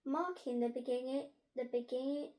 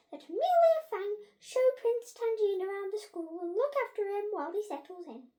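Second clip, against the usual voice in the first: high, loud proclamation to the school; head teacher announcing; 9 semitones higher, 7 dB louder than usual. A narrator's reading. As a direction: announce it grandly, pitched well up.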